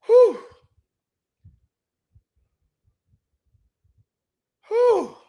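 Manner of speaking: high, sharp blowing-out breath; exasperated exhale. Real, not acted. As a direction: blow off steam with a loud whew. Calming down after a rant.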